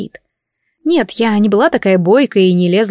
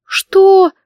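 high, loud, voiced outburst of disbelief; outraged hiss; 12 semitones higher, 5 dB louder than usual. A narrator's reading. Heroine hears scandalous rumour about herself, outraged high hiss. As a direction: blurt it sharply, much higher and louder. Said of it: "прошипела я".

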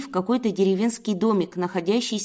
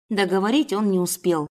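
ordinary delivery

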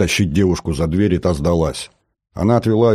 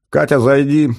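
high, raised summoning call; 8 semitones higher, 4 dB louder than usual. A narrator's reading. Man summons the girl into the room, calling out. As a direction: call someone in from outside. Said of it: "крикнул я".

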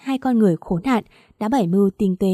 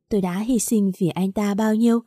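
ordinary delivery, nothing marked

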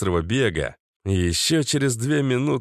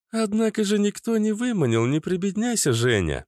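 put-on raised, playful girl's voice; teasing young woman, lightly mocking; high teasing female voice; 11 semitones higher than usual. A narrator's reading. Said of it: "напомнила Лиана".

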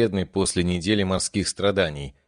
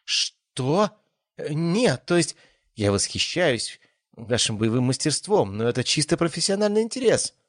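put-on flustered young woman; 8 semitones higher than usual. A narrator's reading. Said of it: "девушка на секунду потеряла самообладание".

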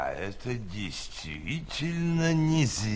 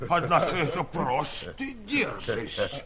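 scoffing laugh; 6 semitones higher than usual. Acted. Scholar scoffs at Gulliver.

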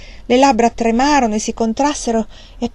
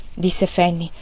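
ordinary delivery, nothing marked

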